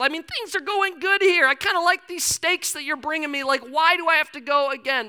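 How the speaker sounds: high, loud whining complaint to God; whiny Elijah. Acted. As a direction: plead with God in a high, loud, put-upon voice.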